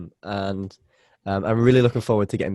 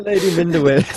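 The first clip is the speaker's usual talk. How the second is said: laughing; 7 semitones higher, 4 dB louder than usual. Real, not acted. Prompting laughing partner.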